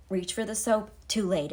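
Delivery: usual speaking voice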